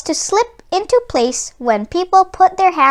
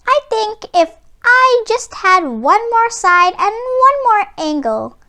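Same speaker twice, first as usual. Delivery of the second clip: higher, lightly voiced wishful triangle; 7 semitones higher than usual. A narrator's reading. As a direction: pitch it up like a small hopeful shape. Triangle asks to change.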